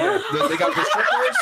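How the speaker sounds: laughing. Real, not acted. Laughing while answering.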